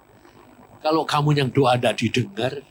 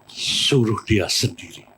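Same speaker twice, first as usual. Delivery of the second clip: suara Tuhan yang lambat dan berat; 5 semitones lower, slower than usual. Retold or imitated. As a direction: ucapkan pelan seperti Tuhan bersabda. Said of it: "Tuhan ini ngomong ini". (Tuhan berfirman pelan kepada pendeta)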